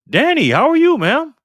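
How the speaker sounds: high, loud, delighted hello; light up and call her name out; thrilled to see a regular back; excited greeting. Real, not acted. Greeting a returning viewer excitedly.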